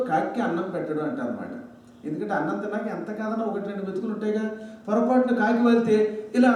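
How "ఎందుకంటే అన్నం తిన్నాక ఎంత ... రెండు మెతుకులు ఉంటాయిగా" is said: ordinary talk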